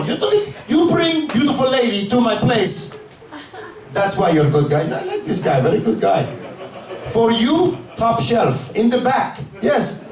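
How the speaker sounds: put-on high, friendly Armenian accent; a gushing Armenian shopkeeper, all charm. Armenian owner flatters his customer in a high, friendly Armenian accent. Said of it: "an Armenian dispensary owner, they were just super nice".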